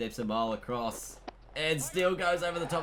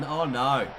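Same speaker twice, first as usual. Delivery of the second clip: dismayed groan; slower than usual. Real, not acted. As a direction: let the disappointment ring out. Reacting to run out.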